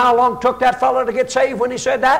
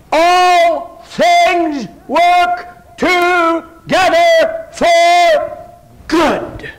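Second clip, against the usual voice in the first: high, loud, drawn out word by word; emphatic proclamation; 8 semitones higher, 5 dB louder, much slower than usual. Acted. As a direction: hold each word long at pulpit volume. Emphatic answer to "How's that?.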